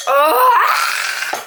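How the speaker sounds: loud, rising cry of frustration; frustrated groan. Real, not acted. Vlogger finding her chocolate burnt, real frustrated cry. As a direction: let out a high, exasperated "oh".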